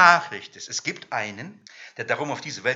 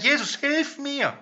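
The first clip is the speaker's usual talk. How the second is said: viel höher, langsam flehend; flehender Ruf; 11 semitones higher, slower than usual. Retold or imitated. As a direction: ruf um Hilfe, hoch und gedehnt. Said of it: "zu ihm gekommen und sagt". (gespielter Hilferuf an Jesus, hoch und langsam)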